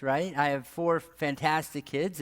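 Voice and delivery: ordinary talk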